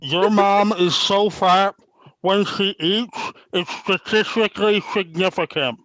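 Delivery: slow, muffled, stick between the teeth; stick in mouth. Real, not acted. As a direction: force the words out around a stick. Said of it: "put something in your mouth and try and talk".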